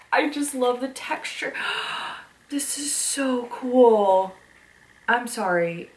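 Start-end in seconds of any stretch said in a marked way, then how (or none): 1.6-2.2 soft, breath-only gasp; awed gasp. Real, not acted. Loving the book's texture, soft awed gasp. 2.5-4.3 slow, drawn-out awe, half whispered; awed delight. Real, not acted. Gushing to viewers over a childhood book, slow awed delight.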